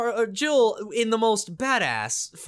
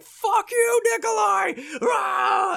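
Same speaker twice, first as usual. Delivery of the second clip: furious shout; 13 semitones higher, slower than usual. Retold or imitated. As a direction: scream it at the villain with a roar. Player screams at Nikolai.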